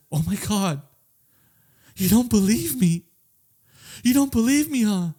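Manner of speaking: put-on high, upset girlfriend pleading. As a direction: beg to be believed, voice climbing. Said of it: "she's like".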